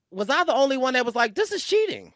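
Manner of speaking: high, disbelieving question; incredulous. Real, not acted. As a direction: ask in disbelief, voice rising high. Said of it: "incredulous question".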